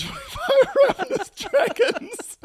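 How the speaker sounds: high, slow villain line through laughter; breaking character giggling. Real, not acted. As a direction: try to stay dramatic but crack up. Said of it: "I couldn't do it with a straight face".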